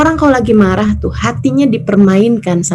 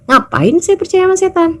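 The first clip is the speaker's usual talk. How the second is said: mock-incredulous question; 9 semitones higher than usual. Wife explaining why she ignores her angry husband, high incredulous. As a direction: toss it off as obvious. Wife's punchline quoted.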